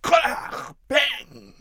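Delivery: high whispered crash, bang; crash noise. Pretend. Child imitating the tree falling, playful noise. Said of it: "machte den Weihnachtsbaum nach".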